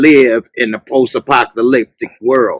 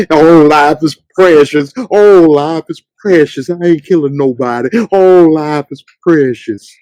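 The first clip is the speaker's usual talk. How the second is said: lightly voiced preachy pacifist; a hint of sanctimonious mockery; mocking Morgan. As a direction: mimic his pious whining. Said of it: "he would kill nobody".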